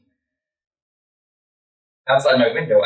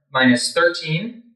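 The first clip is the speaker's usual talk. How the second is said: ordinary delivery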